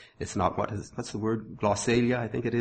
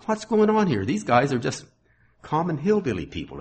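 higher, mock-baffled onlooker; bewildered onlookers; 7 semitones higher than usual. Preacher voicing the amazed crowd, mock bewildered. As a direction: play the puzzled crowd for a laugh. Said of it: "they said".

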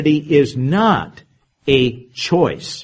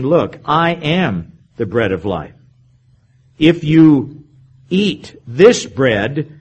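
measured, solemn reading of Jesus's words, slow and deliberate in places; scripture reading; 3 dB louder, slower than usual. Acted. Preacher reading Jesus's words to the congregation, solemn. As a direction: read it out like holy writ.

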